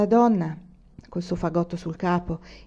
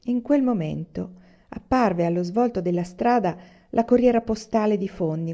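usual speaking voice

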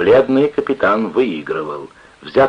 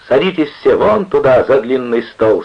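loud, higher-pitched order; commanding; 6 semitones higher, 6 dB louder than usual. A narrator's reading. Captain seating the gang, commanding. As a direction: order everyone to their seats firmly. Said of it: "скомандовал бледный капитан".

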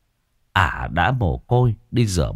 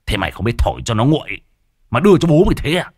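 furious husband; 3 semitones higher, 4 dB louder, much faster than usual. Acted. Husband shouting while hitting wife.

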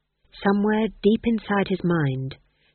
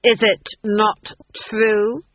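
"is it not true?" louder and higher, hammering each word; insistent repeat; 4 semitones higher, 5 dB louder, slower than usual. Acted. The Duke repeating his demand to Granny, louder and insistent. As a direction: demand the answer a second time, louder. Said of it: "I said".

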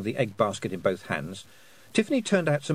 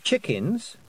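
higher, rising corrective prompt; severe correction; 9 semitones higher than usual. A narrator's reading. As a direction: correct a small child with mild severity. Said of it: "said Tiffany severely".

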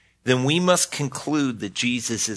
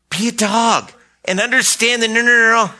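higher, louder insistent no, no, no; emphatic insistence; 8 semitones higher, 4 dB louder, faster than usual. Acted. Pastor insists to congregation after the cat joke, emphatic. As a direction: pile on the no's to drive it home.

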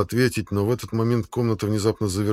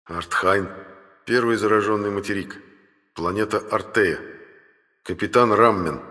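ordinary delivery, nothing marked

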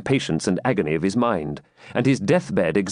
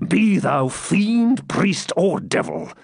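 higher, slow, furious defiance; defiant; 8 semitones higher, slower than usual. A narrator's reading. Dying knight defies the fiend, angry. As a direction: hurl it back at the fiend.

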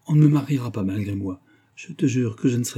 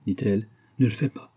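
ordinary delivery

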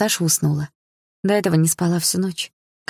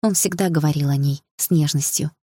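usual speaking voice

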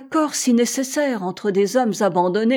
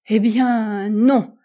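louder, emphatic admission; exclaiming; 5 dB louder, much slower than usual. A narrator's reading. American admits he never sought the Pole, exclaiming. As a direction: blurt out the no after the lead-in. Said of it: "s'écria l'Américain".

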